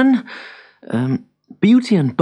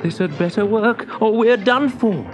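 higher, worried mutter of hope; nervous urgency; 7 semitones higher than usual. A narrator's reading. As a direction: brace for a last attempt. Trapped wizard about to try magic.

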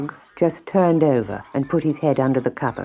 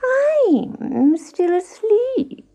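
very high, very slow whine; sleepy cat; much slower than usual. A narrator's reading. Cat pleading sleep to dodge a chore, high drowsy whine.

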